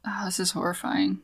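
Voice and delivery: breathy whispered dismay, barely voiced; hushed whisper. Real, not acted. Reacting to a co-host's bad night in a shocked whisper.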